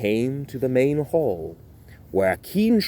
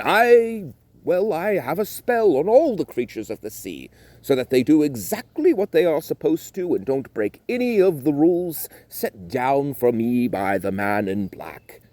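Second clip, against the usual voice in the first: King Triton's voice; 6 semitones higher than usual. Acted.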